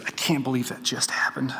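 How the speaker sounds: hushed, breathy whisper of a tearful wife; imitated weeping. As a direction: whisper it as if crying, acted out for the audience. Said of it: "and she's weeping".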